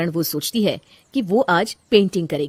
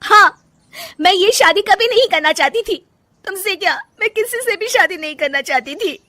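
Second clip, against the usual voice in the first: much higher and loud, bursting out in anguish; outburst; 11 semitones higher, 5 dB louder than usual. Acted. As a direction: finally let it all out. Wife bursts out. (फूट पड़ता दर्द)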